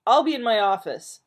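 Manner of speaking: loud, high huff; storming off. Acted. Man storming out on Marilyn, loud huff. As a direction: snap it and leave. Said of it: "storming out".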